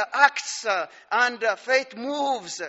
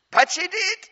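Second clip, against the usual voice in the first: emphatic exclamation; 9 semitones higher, 5 dB louder than usual. Real, not acted. Preacher stresses her daring.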